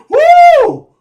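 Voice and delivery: high loud woo of pride; excited whoop. Real, not acted. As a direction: whoop like you just got respect. Hyped whoop again.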